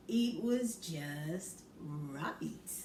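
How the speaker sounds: very slow, drawn out just right; slow savouring. Acted. Reader to child, slow satisfied just right. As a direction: stretch each word out contentedly.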